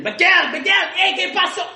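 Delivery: high, loud call from the kitchen; boss yelling. Retold or imitated. Mimicking the boss yelling from the kitchen, high and loud. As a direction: holler his name across the kitchen. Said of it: "He'll, like, yell from the kitchen".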